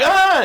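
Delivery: short, high, loud yelp; acted high-pitched shout of alarm; bartender's startled cry. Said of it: "And the bartender's like".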